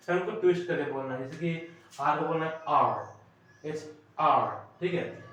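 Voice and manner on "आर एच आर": slow "आर" with the r curled and rolled; demonstrating a rolled r, slowly. Shown as a demonstration. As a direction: stretch "एच आर", rolling the r. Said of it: "आर को थोड़ा सा रोल करके बोलना है".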